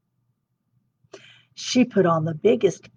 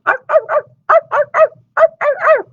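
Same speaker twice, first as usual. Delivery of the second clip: high yappy dog barks; happy barking; 7 dB louder than usual. Acted. The dogs outside, high happy yapping. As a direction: bark like excited huskies. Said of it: "heard her dogs barking happily".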